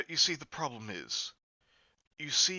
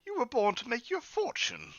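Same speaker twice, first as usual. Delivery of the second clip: Joe voice; 11 semitones higher, 4 dB quieter than usual. Acted.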